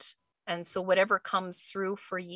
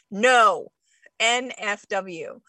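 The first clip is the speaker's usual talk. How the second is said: loud "No", slow spelled "N F W"; emphatic no; 6 dB louder, slower than usual. Retold or imitated. Host voicing an emotional reaction, loud emphatic no. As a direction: refuse it hard, letter by letter. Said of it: "You might be like".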